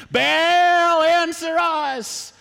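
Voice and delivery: full-throated, high, drawn-out shout. Acted. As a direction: bellow a slow plea to Baal. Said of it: "You should yell a little louder".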